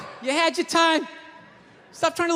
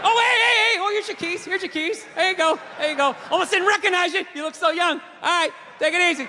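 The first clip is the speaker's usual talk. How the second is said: higher, brightly fake welcome; fake-friendly surgeon; 4 semitones higher than usual. Surgeon greeting an unrecognisable patient, fake cheerful. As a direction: overdo the friendliness to cover up.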